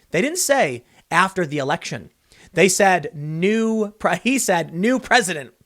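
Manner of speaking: usual speaking voice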